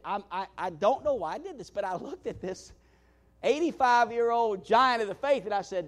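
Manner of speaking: usual speaking voice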